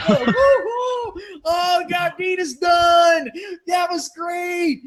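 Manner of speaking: hammed-up gleeful dad; gleeful cheer. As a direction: cheer like the prank went perfectly. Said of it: "It's like".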